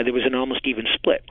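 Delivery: ordinary delivery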